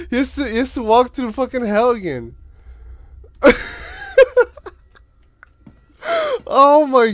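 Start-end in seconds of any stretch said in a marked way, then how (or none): 2.7-4.7 shrieking laugh. Real, not acted. Hysterical laughter.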